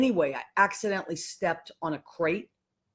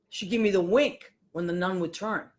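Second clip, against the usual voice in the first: ordinary talk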